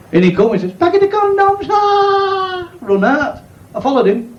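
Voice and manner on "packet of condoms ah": put-on high, strained cry of a nervous young lad; high squeaky young lad blurting his order; lad's voice. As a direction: squeal the order then bolt.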